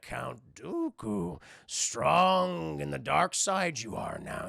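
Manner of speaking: slow, drawn-out creature voice; Yoda. Retold or imitated. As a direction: stretch each word like an old master. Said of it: "He's like".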